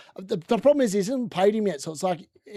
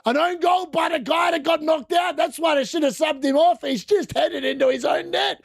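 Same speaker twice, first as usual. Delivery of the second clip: excited shout; 7 semitones higher than usual. Real, not acted. Reacting to the own goal, a high excited shout.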